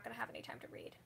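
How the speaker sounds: fast whispered aside. Real, not acted. Telling viewers she won't have time, whispered fast. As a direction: rush it out under your breath.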